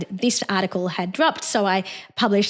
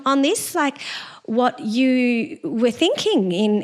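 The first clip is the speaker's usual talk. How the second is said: sing-song voice; 2 semitones higher, slower than usual. Retold or imitated. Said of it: "one of those people with a sing-song voice".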